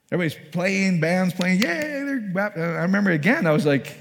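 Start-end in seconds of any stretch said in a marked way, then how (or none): 1.6-2.6 high-pitched mock crowd cheer. Preacher mimicking the crowd at a baptism, mock cheering. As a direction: imitate an over-excited crowd.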